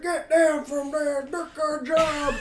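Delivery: angry dad. Retold or imitated. The father shouting at his daughter on the car, mock dad voice. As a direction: play the clueless dad barking from below. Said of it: "he's just like".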